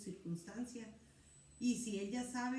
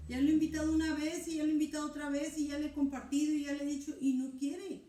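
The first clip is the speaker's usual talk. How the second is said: exasperated rush; 5 semitones higher, 7 dB louder, faster than usual. Acted. Believer voiced listing failed invitations.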